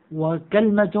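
وكلمة said "wa-KAL-ma", no vowel after l; short a after k, وكلمة as "wakalma"; kalma form. Shown as a demonstration. Teacher gives class the third dialect form, pronounced kalma. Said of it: "على وزن تمرة".

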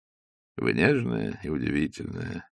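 low, slow, soft and sincere; tender. A narrator's reading. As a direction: say it gently, like a confession. Ostap answers his love tenderly.

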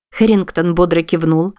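ordinary delivery, nothing marked

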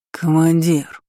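tongue tripping, fake drunk; slurred drunk. A narrator's reading. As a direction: slur it like a staggering drunk. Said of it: "заплетающимся языком". (заплетающимся языком, пьяно)

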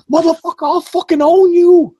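hammed-up, very high furious scream; mom losing it. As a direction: imitate a mother screaming into her kid's headset. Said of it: "losing her shit".

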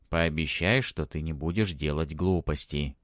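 ordinary delivery, nothing marked

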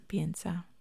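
usual speaking voice

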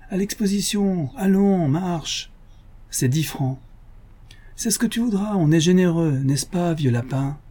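a hint of a cheeky young Parisienne; young cyclist girl. A narrator's reading.

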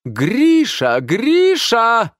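high, loud drawn-out call; yelling out a name. A narrator's reading. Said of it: "и завопил". (завопить, протяжно зовя)

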